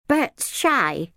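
put-on high-pitched little girl voice; protective child. A narrator's reading. Izzy defends shy Bert to minder, protective. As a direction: speak up high for a little brother.

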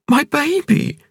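high woman's voice, alarmed question; sudden alarm about the bike; crying out. A narrator's reading. Ping to Cavalier, high alarmed cry about her bike.

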